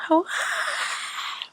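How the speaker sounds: high, whispered mock scream; imitated creepy scream. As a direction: mimic an eerie scream under the breath. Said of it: "it sounded like a scream".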